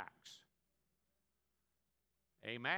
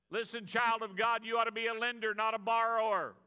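loud, higher, building exhortation; preaching at full force; 5 semitones higher, 8 dB louder than usual. Acted. Preacher exhorting the church to lend, shouting. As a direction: drive it home to the congregation.

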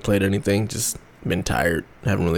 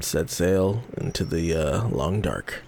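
slow dramatic; 4 semitones lower, 5 dB quieter, slower than usual. Acted. Dramatic phrasing of shutdown.

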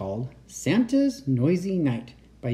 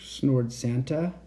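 usual speaking voice

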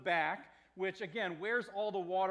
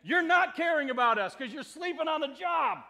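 loud, high, indignant charge; shouted accusation; 7 semitones higher, 10 dB louder than usual. Acted. Disciples shouting at their teacher for sleeping through danger, accusing. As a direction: fling it at him as a blunt statement. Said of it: "Which is really a statement".

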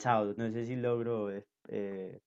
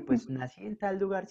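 animated retort; 7 semitones higher than usual. Retold or imitated. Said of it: "retelling own reply".

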